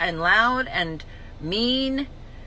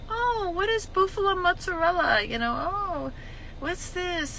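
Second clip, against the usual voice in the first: high, soft airy confusion; clueless deli girl; 8 semitones higher, 5 dB quieter than usual. Retold or imitated. Deli clerk puzzled by the order, high soft and clueless. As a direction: play the lost new clerk. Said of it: "The girl didn't know".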